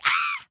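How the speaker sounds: short high loud "Ah!" yelp; a quick shriek to release stress; demo scream. Shown as a demonstration. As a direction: let out one sharp high yell. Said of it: "back up and say".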